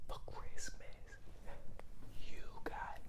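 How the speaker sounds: silent mouthing, barely voiced; mouthing a secret to sister; mouthed whisper. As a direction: mouth the words across the room. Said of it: "and I go".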